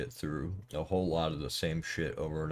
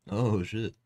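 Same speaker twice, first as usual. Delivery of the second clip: surprised; 6 semitones higher, 8 dB louder than usual. Real, not acted. Sudden game shock.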